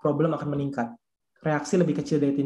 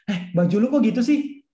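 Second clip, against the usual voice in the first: higher-pitched mock criticism; mock put-down; 5 semitones higher, 4 dB louder than usual. Fault-finder greeting someone about their clothes, mock disparaging. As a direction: pick on someone in a playful imitation. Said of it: "Nah, langsung ngejelekin".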